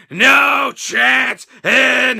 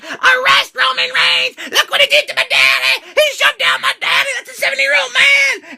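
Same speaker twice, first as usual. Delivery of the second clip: hammed-up high screeching daughter; mock hysterical shriek; shrieking Stephanie; 12 semitones higher than usual. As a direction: wail it like a spoiled brat. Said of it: "she's like".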